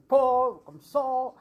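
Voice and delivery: drawn-out, loud imitation of a deep voice. Medium shows how the man talked, mock deep voice. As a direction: mimic his deep voice, slow and loud. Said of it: "euh, il est très grave".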